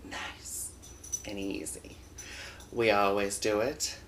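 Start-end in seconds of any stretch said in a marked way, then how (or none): 0.1-2.0 whisper. Acted. Intro whispered before the show.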